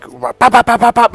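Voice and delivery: high, loud burst of pops; imitated punch noises. Showing why he pushed her away, imitated punch noises. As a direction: fire off punch sounds in a row.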